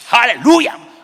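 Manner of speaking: sudden high, explosive hallelujah. Acted. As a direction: belt it out like a revival congregation. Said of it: "흑인교회를 들으면 확".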